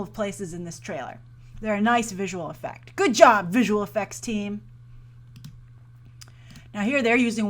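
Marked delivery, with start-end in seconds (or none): none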